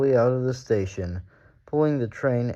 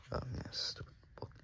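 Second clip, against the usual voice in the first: whispered aside; 3 semitones higher, 19 dB quieter, faster than usual. Real, not acted. Aside off the story.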